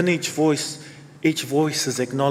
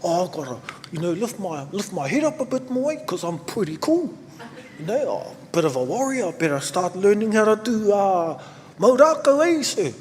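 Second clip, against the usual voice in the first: put-on lightly higher, swaggering kid's boast; a hint of the boy; 5 semitones higher than usual. Boy tells his teacher he'll act like a warrior, lightly higher. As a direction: boast like a cool young warrior. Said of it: "He goes".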